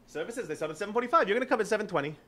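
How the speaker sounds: high, laughing mock rabbi, fast at the end; rabbi's voice. Rabbi with arm on his shoulder, high and laughing. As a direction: play the cheery rabbi who won't take no. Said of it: "Puts his arm on my shoulder".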